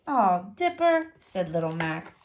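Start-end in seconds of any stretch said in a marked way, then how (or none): 0.1-1.1 disappointed. A narrator's reading. Little Mac let down by refusal.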